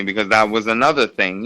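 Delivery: normal talking voice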